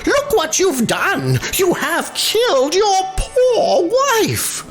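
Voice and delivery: high gasp of dismay; shocked landlord. A narrator's reading. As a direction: landlord aghast at the scene. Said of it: "said the landlord".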